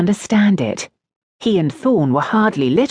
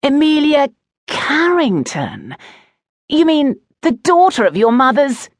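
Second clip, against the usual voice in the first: scandalised schoolboy, pitched up; incredulous discovery; 10 semitones higher, 4 dB louder than usual. A narrator's reading. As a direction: read out the name in gleeful disbelief. Oakenrot reads out name, incredulous.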